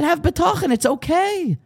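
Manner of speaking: high-pitched reassuring call; imitated grandmother soothing from afar; calming bubby. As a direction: call out high to calm someone down. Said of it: "Calming us down, attempting to".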